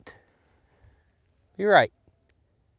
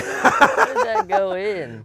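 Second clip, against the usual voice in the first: high, loud, drawn-out incredulous squeal; laughing disbelief; 5 dB louder, slower than usual. Real, not acted. Golfer stunned that the ball went in, squealing in disbelief. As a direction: squeal it slowly through laughter.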